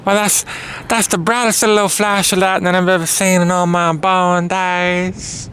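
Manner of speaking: put-on Southern belle drawl; Southern accent. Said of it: "how we talk down south".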